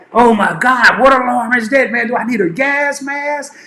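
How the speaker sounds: put-on a scared nineteen-year-old alone, voice climbing; panicked. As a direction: sailor alone in the bathroom hearing the alarm, mildly panicked. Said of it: "I'm like".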